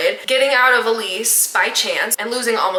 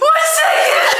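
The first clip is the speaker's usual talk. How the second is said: very high, loud wail; mock crying; 6 dB louder, slower than usual. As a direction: squeal it out in exaggerated tears. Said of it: "and I'm crying".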